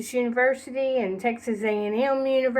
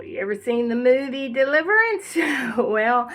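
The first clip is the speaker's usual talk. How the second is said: normal talking voice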